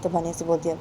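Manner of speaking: usual speaking voice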